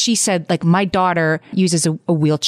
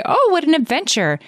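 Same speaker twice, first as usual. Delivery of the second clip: high-pitched mock gushing; mock enthusiasm; 11 semitones higher than usual. People reacting to talk of those years, mock gushing. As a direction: gush it in an imitated higher voice.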